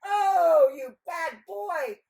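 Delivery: loud, high, drawn-out telling-off; long, shocked rebuke; scandalized scolding. Acted. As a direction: scold a naughty boy at full voice. Scandalized passer-by scolds the boy.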